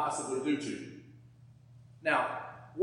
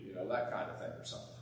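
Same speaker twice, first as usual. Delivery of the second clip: usual speaking voice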